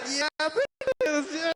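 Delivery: high, slow, hammed-up shy yes; mock modest reply; bashful agreement. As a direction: play it coy and flattered. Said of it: "you'll be like".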